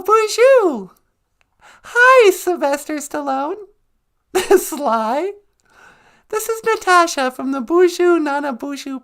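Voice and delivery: very high-pitched little assistant voice; Natasha the assistant. Acted. Assistant phoning a film star for the show, high-pitched voice. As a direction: squeak it out high, loud on the greetings.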